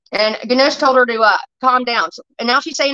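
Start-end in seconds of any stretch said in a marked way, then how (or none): none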